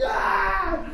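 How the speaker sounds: high, loud yelled "ah"; retold yell. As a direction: reenact a sudden startled scream. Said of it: "and I just yelled".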